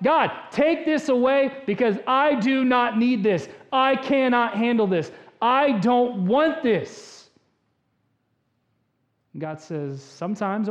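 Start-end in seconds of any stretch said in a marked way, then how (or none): 0.0-7.3 loud, high, emphatic plea; impassioned acted prayer; desperate demand. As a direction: hammer each refusal at God, stretching the last.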